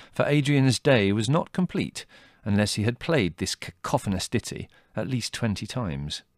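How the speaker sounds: plain reading voice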